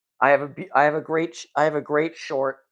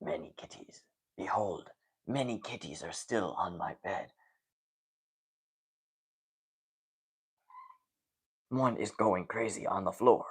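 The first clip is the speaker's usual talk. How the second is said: hushed, lower documentary narration fading to a whisper; softly narrating the wild cats, lower; David Attenborough impression; 7 semitones lower, 9 dB quieter than usual. Shown as a demonstration. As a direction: narrate the cats like a nature film. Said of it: "David Attenborough impersonation".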